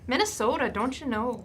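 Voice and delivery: put-on a light, half-hearted Minnesota accent. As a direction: toss off a playful Minnesota accent. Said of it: "my Minnesota accent needs work".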